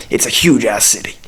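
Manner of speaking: usual speaking voice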